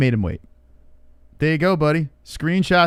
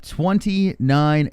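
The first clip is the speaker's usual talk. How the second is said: announcer read; 4 semitones higher, much slower than usual. Acted. Show opener announcement.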